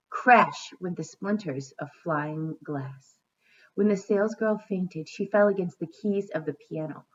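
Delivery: storybook narration; story reading. A narrator's reading. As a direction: read to children at an even pace. Reads a storybook aloud.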